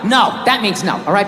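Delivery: ordinary delivery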